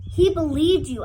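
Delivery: loud, hurt stress on He; angry accusation. Acted. Max accusing Doctor Spivak, a loud outburst.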